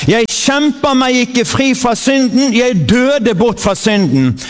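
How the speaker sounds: slow, proclaiming call for the congregation; preacher leading a spoken creed; leading a confession. Acted. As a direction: declare it for them to echo. Leads confession to repeat.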